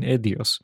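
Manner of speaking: "Eidos" said as ED-ee-us; misread pronunciation. Retold or imitated. Dyslexic gamer recalling how he saw it, "Eidos" mispronounced ED-ee-us. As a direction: pronounce "Eidos" wrongly as ED-ee-us. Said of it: "in my head, I have always seen".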